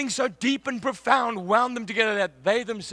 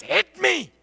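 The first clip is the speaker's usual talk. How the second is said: sharp higher barked command; captain's order, raised and pitched up; retold shout; 7 semitones higher than usual. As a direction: bark it like the team captain. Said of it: "and he shouts".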